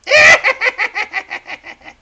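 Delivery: put-on high, loud cackling laugh of an old hag; crone cackle. Old crone cackling at the boy, loud high cackle. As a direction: cackle wildly like a witch. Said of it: "She, like, laughs a bunch just like that".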